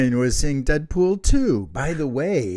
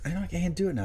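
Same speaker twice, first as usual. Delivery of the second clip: normal talking voice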